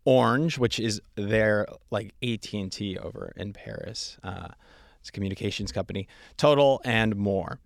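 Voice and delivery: loud, punchy promo read; podcast intro read. Acted. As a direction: sell the guest with big, emphatic phrasing.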